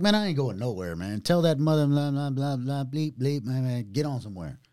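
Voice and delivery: swearing him away, fed up; retold angry brush-off. Retold cursing off the cousin.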